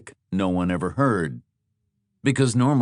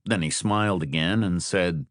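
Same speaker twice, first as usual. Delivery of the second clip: ordinary delivery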